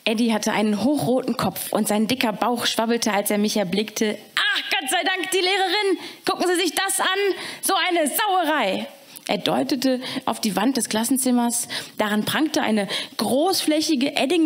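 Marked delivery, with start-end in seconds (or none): 4.4-8.9 high, fussy janitor outburst; upset janitor. Acted. Caretaker Eddie to the teacher, high and flustered. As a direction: play the flustered caretaker pointing at the mess.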